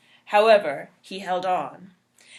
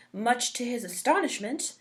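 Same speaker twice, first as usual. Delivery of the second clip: usual speaking voice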